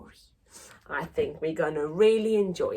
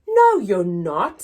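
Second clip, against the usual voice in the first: louder, insistent denial; loud protest; 8 dB louder than usual. Acted. Son firmly contradicting his mother. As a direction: push back firmly.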